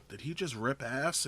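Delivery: soft, under-the-breath question; hushed aside. Retold or imitated. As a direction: mimic students whispering to each other in class. Students quietly asking each other.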